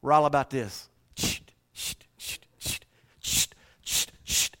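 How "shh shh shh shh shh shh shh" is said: shushing. Real, not acted. Shushing the room.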